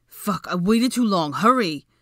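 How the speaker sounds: loud, slow, frantic cry; frantic desperate yell; desperate shout. A narrator's reading. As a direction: yell it like you're about to burst. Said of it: "he repeated desperately".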